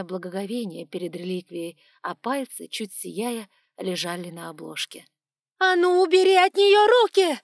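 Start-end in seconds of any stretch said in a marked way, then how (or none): 5.6-7.4 shrill, very loud, high scream. Acted. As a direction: scream in a voice not her own. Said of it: "не своим голосом заорала".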